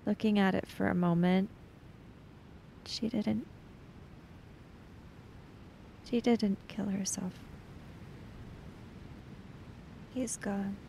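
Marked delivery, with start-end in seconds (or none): 2.9-10.8 soft, broken murmur; stunned hush. Acted. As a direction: let it sink in, almost whispering. Chiaki's stunned realization.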